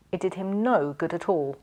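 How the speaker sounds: ordinary delivery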